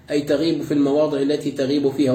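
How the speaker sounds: normal talking voice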